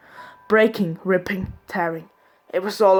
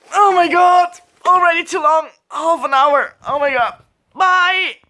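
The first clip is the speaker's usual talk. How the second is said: very high, loud squealed outburst; mock hysterics; 9 semitones higher, 8 dB louder than usual. Vlogger cutting off an overlong video, mock hysterics. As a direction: cry out high and loud, hammed-up. Mock panic at length, signing off.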